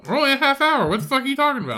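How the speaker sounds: high exasperated disbelief; incredulous. Real, not acted. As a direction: raise it in disbelief. Incredulous at partner.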